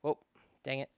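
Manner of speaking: ordinary talk, nothing marked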